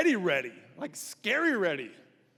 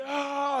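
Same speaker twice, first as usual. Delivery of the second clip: battle yell; 7 semitones higher than usual. Acted. They start yelling.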